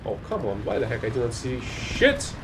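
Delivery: ordinary talk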